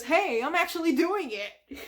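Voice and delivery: a hint of a boastful sponge; SpongeBob voice. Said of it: "he says".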